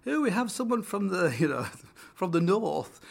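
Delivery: high, put-on plummy host voice; lightly mock the posh welcome; hammed-up posh presenter. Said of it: "super posh and kinda super nice".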